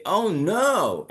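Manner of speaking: high, lifted cry of dismay. Shown as a demonstration. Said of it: "try to raise your voice on it".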